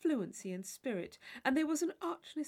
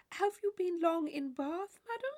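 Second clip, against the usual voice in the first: simpering affected voice; 9 semitones higher, 4 dB louder than usual. A narrator's reading. Tilney mocking a ballroom partner with a simper. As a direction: put on a sugary ballroom simper. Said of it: "affectedly softening his voice, he added with a simpering air".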